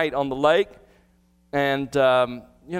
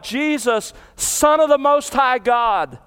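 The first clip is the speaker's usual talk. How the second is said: higher, louder, slow mock cry; retold demon scream; 6 semitones higher, 3 dB louder, slower than usual. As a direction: call the title out as the demon, hammed-up. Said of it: "Notice he screams out".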